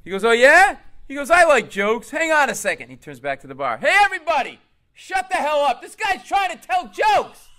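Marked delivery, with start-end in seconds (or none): 0.2-0.8 loud, high rising shout; heckler challenge. Acted. Bleeding heckler challenges him. 1.3-2.9 high, loud tough-guy reply; acted belligerent shouting; loud heckler. Bleeding heckler answers loudly. 3.8-7.5 high, loud full-throated scream; screaming heckler. Acted. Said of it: "he was screaming so much".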